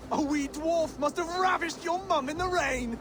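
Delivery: triumphant insult. Acted. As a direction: land the final jab with glee. Punchline of the flyting insult.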